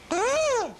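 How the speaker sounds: high startled gasp; quick high gasp; sharp gasp. A narrator's reading. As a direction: gasp in surprise.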